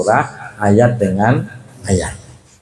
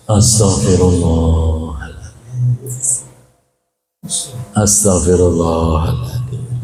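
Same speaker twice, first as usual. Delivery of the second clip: calming prayer; 6 semitones lower, slower than usual. Shown as a demonstration. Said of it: "tarik nafas".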